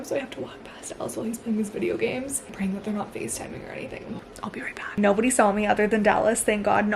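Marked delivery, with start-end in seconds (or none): 0.0-5.0 hushed whisper sneaking past. Real, not acted. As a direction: whisper so nobody on the call hears. Sneaking past boyfriend gaming.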